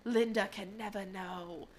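slow imitation of a cartoon character's accent; Gail's accent. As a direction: draw it out in a mock character accent. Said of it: "I love her accent so much".

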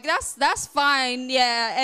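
slow, loud, grudging "that's fine"; grudging agreement. Retold or imitated. In the car, grudgingly telling her husband, slow and loud. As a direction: give in through your teeth, slowly. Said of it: "and I'm like".

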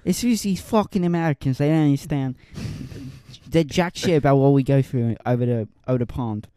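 mock British grumble, part Australian; muddled British accent. Griping about Americans across the pond, muddled British accent. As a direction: complain in a wobbly put-on British accent. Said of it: "that accent sounded like a British".

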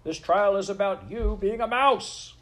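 high, loud scolding from a judge; angry shout. A narrator's reading. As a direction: shout the accused down, pitch climbing. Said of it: "shouted the very".

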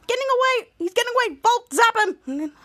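high, pressing plea to act; urgent alarm. Acted. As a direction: urge a teammate before he escapes. Captain urges as villain escapes.

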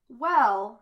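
loud, perky opener; chirpy. A narrator's reading. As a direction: chirp it cheerfully. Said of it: "Mabel chirps".

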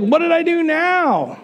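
put-on high, aggrieved kid-brother complaint; mock wronged little brother; little brother protest. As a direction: protest your innocence in a high pitch. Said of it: "he's like".

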